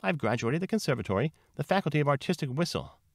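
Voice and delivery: high, affected cow character; self-important cow. A narrator's reading. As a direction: boast in a high put-on voice. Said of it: "then began to talk about herself".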